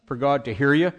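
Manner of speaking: usual speaking voice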